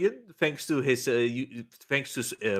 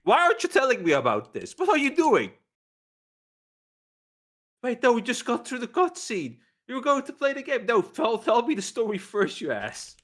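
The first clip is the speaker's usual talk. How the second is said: high-pitched, mock-outraged interruption; exasperated cousin; 10 semitones higher than usual. Cousin cuts off the uncle's story to demand more, high mock exasperation. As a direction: squawk in disbelief like a fed-up cousin. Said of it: "the cousin is like".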